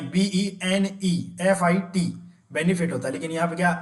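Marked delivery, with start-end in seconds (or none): 0.0-2.0 letters drawn out one at a time; teacher stressing the correct letters slowly; very slow spelling. Shown as a demonstration. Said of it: "ना कि एन आई".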